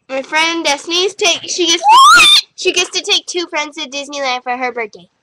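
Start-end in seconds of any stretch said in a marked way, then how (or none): none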